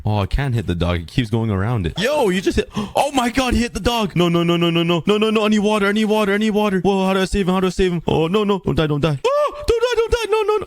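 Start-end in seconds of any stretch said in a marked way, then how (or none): 2.0-10.7 panicked. Real, not acted. Panicking as dog levitates away.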